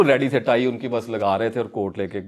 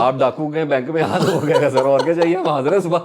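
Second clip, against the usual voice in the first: laughing; 5 semitones higher, 8 dB louder than usual. Real, not acted. Joking about his lucky break.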